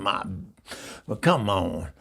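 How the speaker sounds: usual speaking voice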